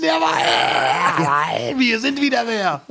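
high, excited victory shout; triumphant cheer. Real, not acted. Guest cheering his quiz win to the host, excited. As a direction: crow over the win.

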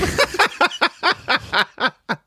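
laughing hard at own joke; big high laugh; high burst of laughter. Real, not acted.

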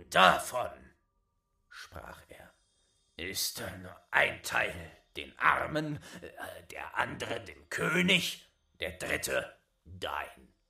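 loom over the little wretch; menacing old giant. Acted.

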